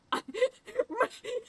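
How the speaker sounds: high wheezing laugh near crying; teary laugh. Real, not acted. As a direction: laugh till it almost cries.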